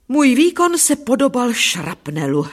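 plain reading voice

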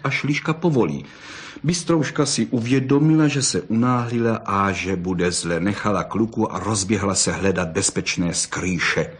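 usual speaking voice

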